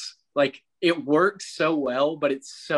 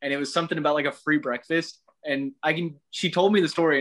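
usual speaking voice

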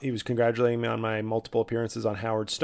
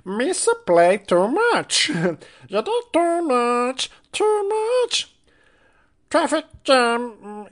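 high, slow, goofy singer; Jar Jar Dave Matthews voice; 13 semitones higher, 8 dB louder, slower than usual. Retold or imitated. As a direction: warble like Jar Jar singing. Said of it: "[name] and Jar Jar Binks".

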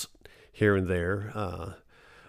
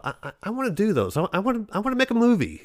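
put-on high, fast, tumbling words of a hopeful young actor; eager rush; 12 semitones higher, much faster than usual. Young actor voicing his old eagerness, fast and high. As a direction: blurt it out like you can't wait. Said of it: "But I thought".

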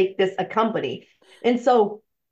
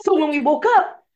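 normal talking voice

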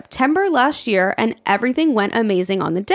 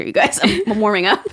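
ordinary delivery

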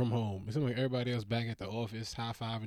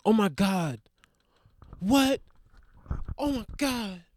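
high, loud, overwrought soap reactions; mock teen-drama hysteria; 10 semitones higher, 6 dB louder than usual. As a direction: play a melodramatic meltdown for laughs. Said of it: "a bunch of people crying".

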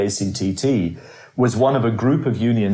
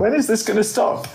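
high mock despair; weary comic whine, much higher; exasperated; 11 semitones higher than usual. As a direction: throw up your hands in a squeaky high voice. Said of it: "I was like".

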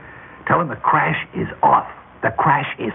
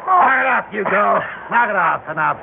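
high, raised command; barked command to quit; sharp stop order; 7 semitones higher than usual. Acted. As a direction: call the henchman off. Boss stops the beating.

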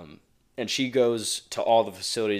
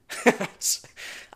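laugh; 13 semitones higher than usual. Real, not acted. Laughs at own damp joke.

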